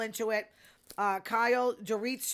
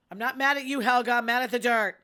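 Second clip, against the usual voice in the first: mock angry retort; 2 semitones higher, 8 dB louder, faster than usual. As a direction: fire it back at her in a fight. Acted quarrel, retold insult.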